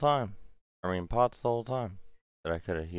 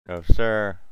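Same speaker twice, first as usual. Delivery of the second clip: loud; 12 dB louder than usual. Real, not acted. Reacting to game moment.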